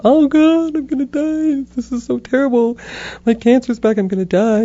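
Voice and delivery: high, melodramatic wail, easing down toward the end; mock despairing wail. Patient dramatizing her panic at cancer's return, mock despairing wail. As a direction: wail it in hammed-up dread.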